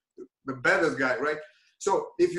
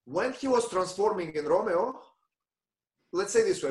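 ordinary talk, nothing marked

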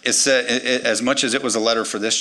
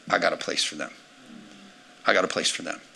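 low tender promise; 6 semitones lower, 5 dB quieter, faster than usual. Acted.

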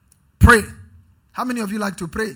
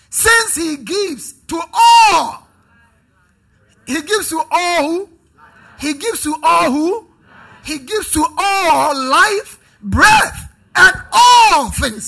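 high, shouted call-and-response scripture reading; full-force preaching; 7 semitones higher, 11 dB louder, slower than usual. Real, not acted. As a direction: shout it out to rouse the congregation, stretching key words.

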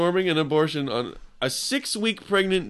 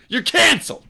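mock shout; 12 semitones higher, 5 dB louder than usual. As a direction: announce it like a verdict. Joke podcast name, shouted.

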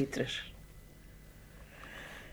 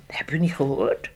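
whispering; 4 semitones lower than usual. Retold or imitated. People answering in the street.

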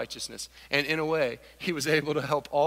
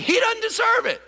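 shouted answer; 6 dB louder than usual. Real, not acted. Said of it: "Say it".

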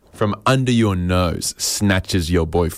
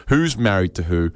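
usual speaking voice

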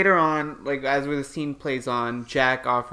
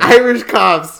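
loud, higher whooped call-out; mock cheering shout; 5 semitones higher, 12 dB louder, much slower than usual. Host teasing co-host over the cop name, shouted cheer. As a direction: holler it like a rowdy toast.

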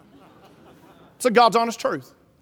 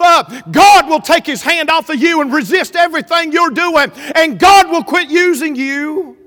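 raised pitch and volume, building to a warning; full-force preaching; 6 semitones higher, 7 dB louder than usual. Real, not acted. Preacher warns congregation against lifting themselves up, full-force preaching. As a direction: thunder the climax at the congregation.

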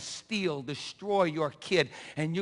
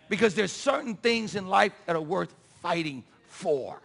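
normal talking voice

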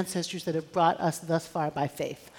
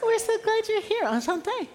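standup comic crowd work; 13 semitones higher than usual. Real, not acted. Playful French welcome.

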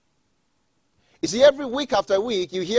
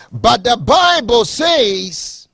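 rising preaching; 8 dB louder, slower than usual. Acted. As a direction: build to a full preaching cry. Preaching builds to climax.

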